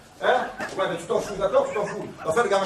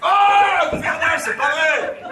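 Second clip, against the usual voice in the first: mock shout; 6 semitones higher, 5 dB louder, much slower than usual. Exclamation jouée au téléphone.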